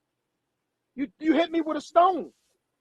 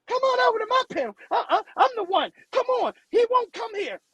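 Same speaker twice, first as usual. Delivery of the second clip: high, fast sing-song mockery of a pushy host; high mocking voice inviting everyone onto the panel; mocking impression; 9 semitones higher than usual. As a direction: squeak it out like a needy YouTuber begging guests.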